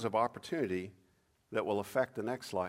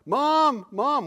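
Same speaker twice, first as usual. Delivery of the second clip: put-on much higher, loud call of a lost child; child calling mom; 14 semitones higher, 12 dB louder than usual. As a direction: call out for mom like a frightened kid.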